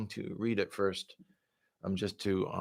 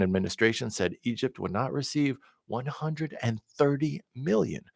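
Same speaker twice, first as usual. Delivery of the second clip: steady, measured newsreader delivery; news read; 4 semitones higher, 9 dB louder than usual. Acted. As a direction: read the story like a broadcast bulletin. Reads news articles aloud.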